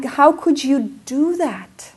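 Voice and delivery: slow, loud, high accusing question; self-scolding. Retold or imitated. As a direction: drag out the blame on yourself. Self-blame over lost camera.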